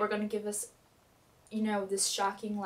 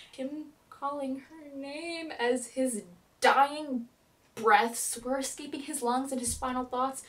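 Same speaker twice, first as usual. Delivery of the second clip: ordinary talk, nothing marked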